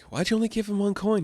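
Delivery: high-pitched mock dad's complaint; questioning dad. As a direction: lift the pitch into a puzzled parental question. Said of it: "and his dad's like".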